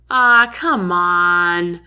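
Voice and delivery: drawn-out slow whine; whiny complaint. A narrator's reading. Soldiers whining at Jack, slow groaning complaint. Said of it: "a few voices complain in unison".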